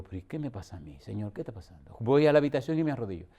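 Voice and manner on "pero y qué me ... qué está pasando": much softer and lower, asking inwardly; hushed worried prayer; anxious quiet prayer. Retold or imitated. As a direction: murmur it to God, unsettled. Praying anxiously to himself.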